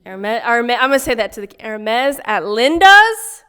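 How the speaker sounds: loud call across the room. Real, not acted. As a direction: belt it out like a shout-out. Calls out loudly to the store.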